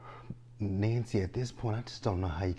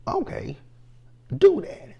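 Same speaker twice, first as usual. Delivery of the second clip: mocking squeal; 8 dB louder than usual. Mocking Bobby's reply.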